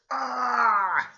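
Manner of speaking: high, loud drawn-out "ah"; imitated pain scream. Sasuke bitten in the curse mark, acted scream. Retold reaction to being bitten.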